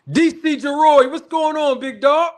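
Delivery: calls out high and loud to a friend; loud greeting. Real, not acted. Streamer shouting a welcome to a viewer in chat, loud and high. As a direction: hail him across the room.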